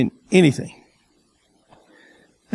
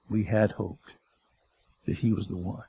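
a soft, lower voice, hope draining away; hushed lament; 14 semitones lower, 14 dB quieter than usual. Retold or imitated. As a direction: let the words sink quietly, almost to nothing. Disciples' lost hope retold.

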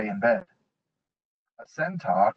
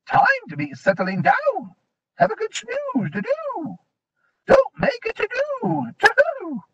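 very high drowsy owl voice; a half-asleep owl hooting his words; high hooting owl speech; sleepy owl. A narrator's reading. Owl's sleepy reply.

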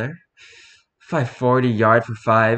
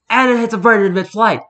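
building to a louder, higher punch on hits a bird; excited punchline; 7 semitones higher, 4 dB louder than usual. A narrator's reading. Rereading the golf joke to deliver the bird reveal, excited. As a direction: land the punchline with a burst of glee. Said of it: "Right along the".

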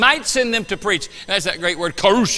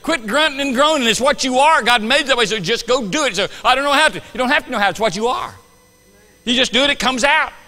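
louder, higher, urging the congregation on; fervent preaching; 8 semitones higher, 8 dB louder than usual. Real, not acted. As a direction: drive it home to the flock. Preaching at full force.

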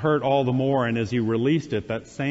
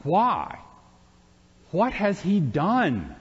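higher, slow disbelieving question; incredulous; 6 semitones higher, slower than usual. A narrator's reading. Pilate asks the crowd why, incredulous. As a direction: Pilate can't believe what he hears. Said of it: "Pilate was incredulous".

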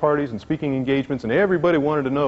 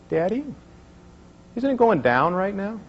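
put-on higher, innocent little-girl question; 8 semitones higher than usual. Daughter asking her dad about the chart, in a higher little-girl voice. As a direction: ask it like a small curious child.

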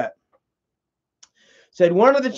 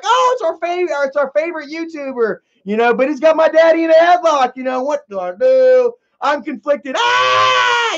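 put-on conflicted kids; 6 semitones higher than usual. Kids voiced torn and panicking.

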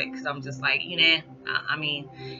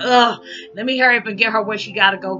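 irritated; 5 semitones higher, 6 dB louder, faster than usual. Retold or imitated. Irritated by daughter's anger.

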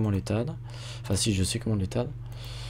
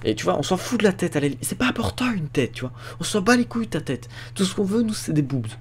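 mock viewer voice; 8 semitones higher than usual. Mocking what viewers want.

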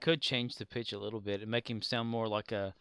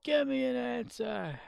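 high mock demand; 12 semitones higher, 4 dB louder than usual. Echoing chat's demand.

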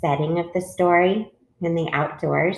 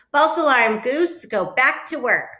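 brisk dismissal; 6 semitones higher than usual. A narrator's reading. Duck sends Goose back to work, brisk dismissal. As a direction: call off the alarm, voice raised.

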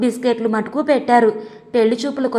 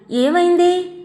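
much higher, alarmed ask; startled worried mother asking what happened; startled question; 7 semitones higher, slower than usual. Retold or imitated. Bride's mother startled by the cry. (కంగారుగా అడిగిన ప్రశ్న)

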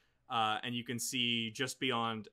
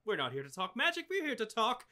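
higher, rattling grandmotherly chatter, fast; doting old hostess, quick; 7 semitones higher, faster than usual. Acted. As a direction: rush through it like a busy grandma. GM voices Ruth, quick and chatty.

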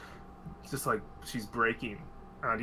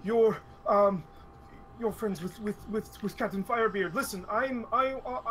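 higher, nervous stammering excuse; flustered high stammer; 6 semitones higher than usual. Acted. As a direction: caught red-handed, fumble words.

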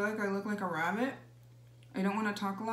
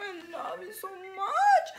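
gushing; 8 semitones higher, 6 dB louder, much slower than usual. Pretend. Mom gushing at child.

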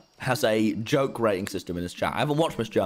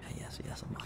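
gleeful whisper; 17 dB quieter, much faster than usual. Real, not acted. Whispered aside, gloating.

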